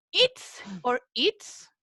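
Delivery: "Its or its" said slowly, both "its" sounded the same; both forms pronounced alike, slowly; identical its. Shown as a demonstration. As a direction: draw out "its" twice with the same sound. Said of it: "Se pronuncian igual".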